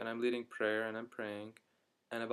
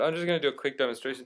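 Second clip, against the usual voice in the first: usual speaking voice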